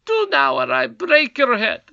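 high, loud bullying squeal; squeaky goblin threat. A narrator's reading. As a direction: threaten with puffed-up bravado. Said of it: "cried the Crown Prince valiantly".

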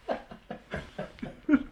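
high, mostly voiceless laugh; real burst of high, airy laughter. Real, not acted. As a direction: crack up at a friend's confession. Laughing at the co-host's confession.

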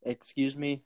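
normal talking voice

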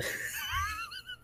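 high, breathless wheezing laugh. Real, not acted. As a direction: laugh until you wheeze. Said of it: "Sorry, I'm wheezing".

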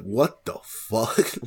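slow, stretched, rising in disbelief; mock bewildered what-the-fuck, dragged out; drawn-out confused. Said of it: "It's like".

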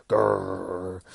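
loud imitated animal growl; drawn-out mock snarl; little wolverine growl. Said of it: "And it's all like".

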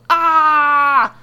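high, very loud astonished outburst; theatrical roar of disbelief; bellowing. A narrator's reading. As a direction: bellow in overblown amazement. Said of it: "He bellows".

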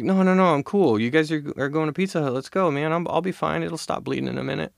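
high-pitched insistent reassurance; imitated carefree friend. Acting out friend brushing it off.

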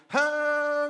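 very high, loud shrieking ha; mock shriek. Joking about Lion King accents, loud shrill ha. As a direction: yelp it out, very high.

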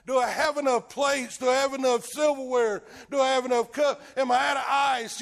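mildly hammed-up fussing; worried Martha. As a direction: voice mock worry, rattling through a mental checklist. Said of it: "All worried about what the house looks like".

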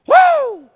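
very high, very loud joyful whoop; excited whoop. Pretend. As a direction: cut loose like a sugar rush hit. Sugar high after donut.

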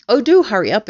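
loud, high hurry-up; urgent plea. A narrator's reading. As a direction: chivvy everyone impatiently. Urging helpers before Twits return.

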